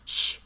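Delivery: sharp hushing sound; imitated shush. Podcaster repeats how stylist shushed the bridesmaid, imitated shush. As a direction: mimic him silencing her with a shush. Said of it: "He fucking shushes her".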